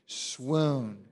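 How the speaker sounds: "Swoon" held long and soft; drawn-out SWOON. Shown as a demonstration. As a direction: savour "Swoon" on one stretched vowel. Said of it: "Say it with me".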